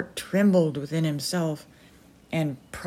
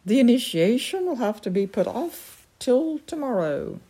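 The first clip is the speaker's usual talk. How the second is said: a higher, lightly voiced haughty hawk-lady; the Peregrine; 7 semitones higher than usual. The Peregrine postponing the initiation, mildly haughty. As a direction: announce the delay like a disappointed duchess.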